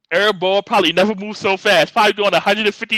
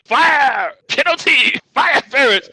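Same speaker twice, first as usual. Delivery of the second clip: higher, excited crowd calls; hammed-up crowd shouting penalty; mock crowd yelling; 7 semitones higher, slower than usual. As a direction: call out the fouls like a crowd. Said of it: "Everybody looking like".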